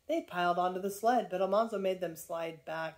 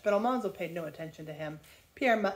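usual speaking voice

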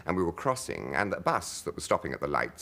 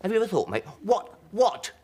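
higher, louder, urgently earnest imitation of Hancock; terribly earnest; 13 semitones higher, 5 dB louder than usual. Hancock pressing his friend about God, imitated earnest and loud. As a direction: press the big question on your mate, half laughing. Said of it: "he got terribly earnest".

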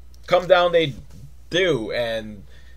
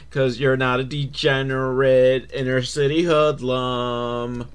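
ordinary talk, nothing marked

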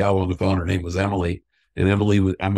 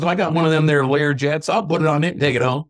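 higher-pitched Kentucky twang; hammed-up Kentucky accent, pitched up; heavy Kentucky accent; 6 semitones higher than usual. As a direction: imitate a Kentucky dad talking big. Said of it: "he's got a heavy Kentucky accent".

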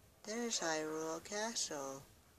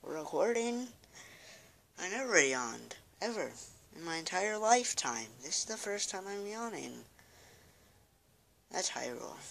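normal talking voice

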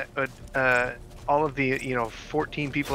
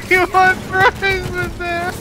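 mock crying voice; 8 dB louder, slower than usual. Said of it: "a crying voice".

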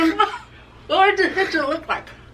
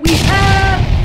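announcer shout; 5 semitones higher, 8 dB louder than usual. Real, not acted. Announcing special guest.

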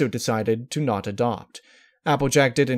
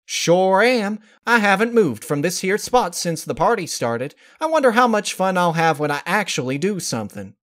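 somewhat higher, louder folksy voice; a hint of a higher country mare; lightly voiced Applejack; 8 semitones higher, 4 dB louder than usual. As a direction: joke wryly about sitting still.

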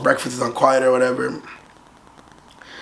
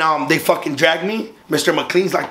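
normal talking voice

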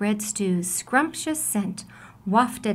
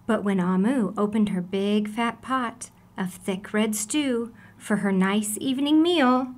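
ordinary delivery

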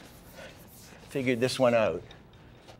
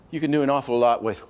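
usual speaking voice

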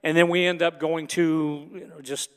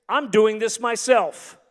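raised, slow, insistent declaration; frustrated defiance; 4 semitones higher, 4 dB louder, slower than usual. Acted. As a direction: declare stubbornly that you'll go it alone. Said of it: "We get frustrated and then we say".

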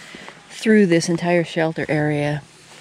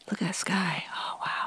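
whispering; 9 dB quieter than usual. Real, not acted. Quiet morning remark at camp.

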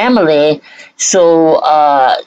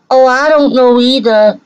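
higher, sneering repeat of her words with an added "Oh"; mocking echo; 7 semitones higher than usual. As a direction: mimic her again, even more scornful.

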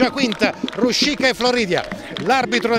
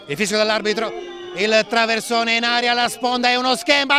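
usual speaking voice

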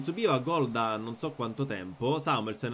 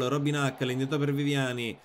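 normal talking voice

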